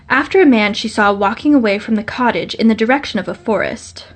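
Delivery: plain reading voice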